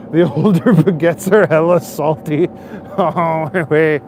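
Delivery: loud, laughing through the words; laughing while talking. Real, not acted. Streamer amused by joke about older French speakers, laughing out loud.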